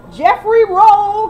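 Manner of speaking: loud, high, slow drawn-out call; mock yell. Mocking Kelly calling her husband, slow loud yell. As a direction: stretch the name out in a holler.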